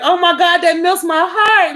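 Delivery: high, drawn-out swoon; gushing. Retold or imitated. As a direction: imitate her melting with delight, pitch way up. Acts out her delighted reaction.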